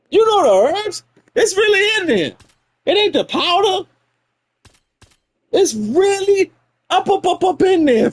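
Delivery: high, playful voice; mock enthusiast. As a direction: hype it up in a squeaky voice. High mock voice about beer.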